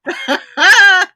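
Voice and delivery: high, loud delighted cry of his name; delighted exclamation. Real, not acted. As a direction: call his name out, tickled by the joke. Host reacts to guest's joke.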